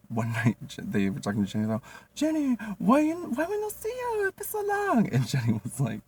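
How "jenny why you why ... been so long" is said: very high falsetto, broken English; mock customer voice. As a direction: chirp it like a friendly customer. Voices the nail salon family.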